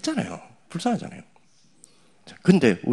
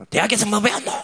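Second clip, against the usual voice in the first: much higher mocking boss voice; boss scolding; 10 semitones higher, 4 dB louder than usual. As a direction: imitate a boss demanding answers. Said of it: "이러면서". (신입을 다그치는 상사를 높은 목소리로 흉내)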